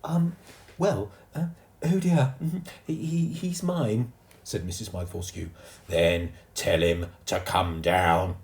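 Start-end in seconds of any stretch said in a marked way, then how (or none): 0.0-4.1 high, stammering lady; embarrassed posh mother; flustered mother. A narrator's reading. 5.9-8.4 slow, louder order; stern guard. A narrator's reading.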